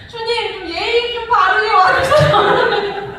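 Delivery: high, loud, drawn-out plea; hammed-up wailing appeal; mock protest. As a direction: complain to God with comic exasperation. Acts out complaint to God, laughing.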